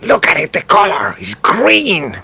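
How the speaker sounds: high-pitched excitable goblin voice; squabbling goblin. Acted. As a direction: squeak it out like a quarrelsome little goblin.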